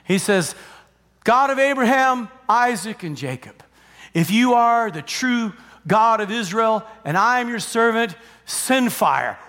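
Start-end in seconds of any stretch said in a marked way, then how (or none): 1.2-9.4 raised, declaimed prayer, dropping at phrase ends; acted solemn invocation in measured phrases; praying prophet. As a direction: call out to God as a prophet. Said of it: "he prays one simple prayer".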